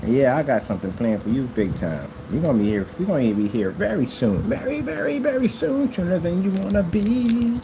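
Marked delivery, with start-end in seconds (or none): 4.4-7.6 high-pitched, drawn-out taunt; teasing threat. Pretend. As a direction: tease them with a mock warning, voice up high.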